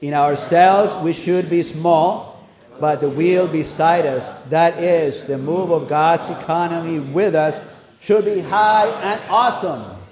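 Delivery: loud, measured recitation; emphatic public reading; leading a group reading. Real, not acted. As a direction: read it out firmly for all to follow. Leads outline reading aloud.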